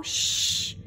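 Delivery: a soft unvoiced hush cutting in; interrupting shh. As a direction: hush her mid-sentence. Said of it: "interrupted Papa".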